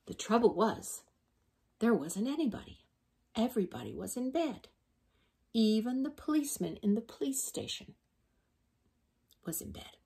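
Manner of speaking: plain reading voice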